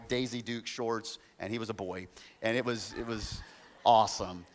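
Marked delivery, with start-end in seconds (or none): none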